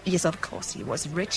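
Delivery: ordinary talk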